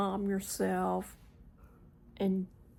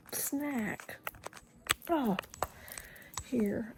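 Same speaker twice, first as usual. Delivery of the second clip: ordinary talk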